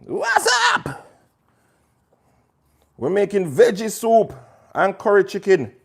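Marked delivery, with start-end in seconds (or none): 0.1-1.1 high, loud excited shout-out; big happy hello; excited greeting. Real, not acted. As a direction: call out to a friend joining the stream. Greeting viewer on live stream.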